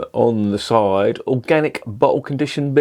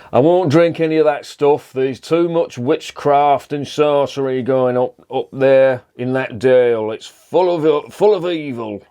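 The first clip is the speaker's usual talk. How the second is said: put-on a light northern English accent, higher and slower; 4 semitones higher, slower than usual. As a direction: play a grumpy Yorkshireman with a hint of northern English accent. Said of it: "in a northern accent".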